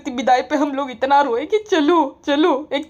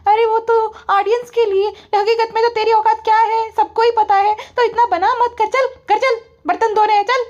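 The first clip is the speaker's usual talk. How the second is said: bossy squeaky wife; 8 semitones higher than usual. Retold or imitated. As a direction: order him about in a high squeak. Wife bossing her husband.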